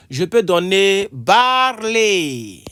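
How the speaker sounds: barley called out loud and slow; teacher announces the next word to the class loudly; announcing new word. Shown as a demonstration. Call out the fifth word loudly and slowly. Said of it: "Cinquièmement".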